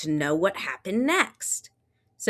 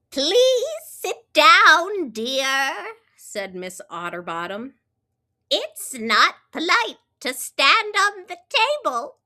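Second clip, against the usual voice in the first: librarian; 7 semitones higher, 5 dB louder than usual. Acted. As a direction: correct him politely. Librarian voiced.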